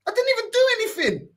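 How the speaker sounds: very high, incredulous plea; protesting innocence. Retold or imitated. Man retelling his answer to her freak-out, high-pitched protest. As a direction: act out baffled innocence, pitch right up.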